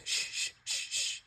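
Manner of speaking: urgent unvoiced shushing; leaning in to silence the company. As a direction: hush everyone with a sharp shh. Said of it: "he leans over and he goes".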